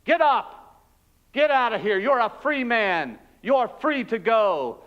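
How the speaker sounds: the guard yelling into the cell for the hidden prisoner. Acted. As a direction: bark the orders into the dark cell. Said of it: "he yelled at me".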